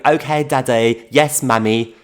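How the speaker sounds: put-on high, loud child's voice with a Portuguese twang; little boy answering. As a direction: imitate a small boy answering his mum. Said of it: "a little four-year-old going".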